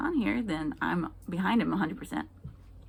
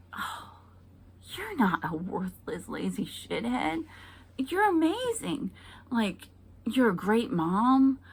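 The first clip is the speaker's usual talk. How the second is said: gushing supportive husband; 5 semitones higher than usual. Pretend. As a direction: lay the praise on thick and sweet. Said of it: "to say".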